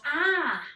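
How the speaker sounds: high yelled "Ah!" as he falls; startled yelp from a fall; yelled cry. Retold or imitated. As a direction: cry out as you slip. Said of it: "he yelled".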